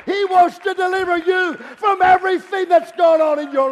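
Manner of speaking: loud, driving pulpit delivery; impassioned revival preaching; full-force preaching. Real, not acted. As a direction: build the promise to a ringing shout.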